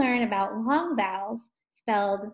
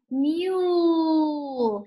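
MULE with a long U, pitched up; stretched vowel; 5 semitones higher, 3 dB louder than usual. Shown as a demonstration. Teacher showing the long U sound, MULE stretched high.

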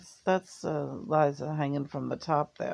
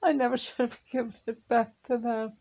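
upset; 6 semitones higher, 4 dB louder, faster than usual. Real, not acted. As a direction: voice high and strained with regret. Grieving over giving dog back.

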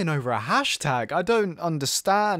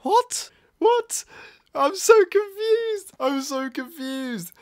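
baffled, high-pitched disbelief; stunned confusion; 12 semitones higher, slower than usual. Real, not acted. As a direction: squeak it out in shock. Stunned reaction.

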